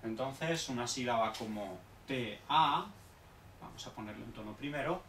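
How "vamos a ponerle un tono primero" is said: normal talking voice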